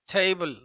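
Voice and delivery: थेबल said with a clear ei glide; pronunciation demo. Shown as a demonstration. Teacher showing exam answer थेबल, careful demo. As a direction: spell out थेबल sound by sound. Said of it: "मतलब ऐसा करेंगे".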